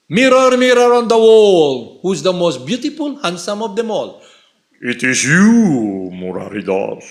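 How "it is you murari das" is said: mirror's reply. Pretend. Mirror answers vain question.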